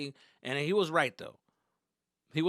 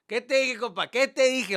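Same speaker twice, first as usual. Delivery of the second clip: high, loud Spanish dad imitation; gloating dad; 11 semitones higher, 11 dB louder than usual. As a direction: catch a son out, loudly. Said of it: "He's like".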